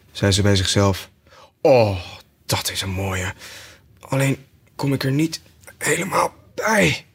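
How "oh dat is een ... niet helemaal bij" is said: Alice to herself. Acted. Alice reaching for bulrushes.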